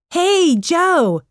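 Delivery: high-pitched friendly hail; calling out. A narrator's reading. Boy passing by greets Joe, calling out. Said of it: "he called".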